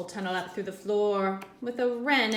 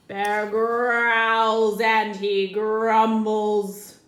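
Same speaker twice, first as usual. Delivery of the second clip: loud, growling, slowing bear voice; growly bear narration; 11 dB louder, slower than usual. Acted. Reading to children as the bear wakes, loud growling. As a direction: growl the words like an angry waking bear.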